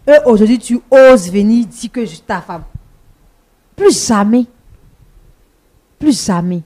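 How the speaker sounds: loud, emphatic rejection; angry indignation. Real, not acted. Wife rejecting the husband who left her, loud indignation. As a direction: hurl it at him, loud and final.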